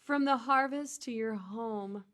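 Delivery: seduce the listener with a carrot bag; sexy voice. Pretend. Reads the carrot bag to her co-host as a sexy hotline voice. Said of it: "I'm gonna read it in my sexy voice".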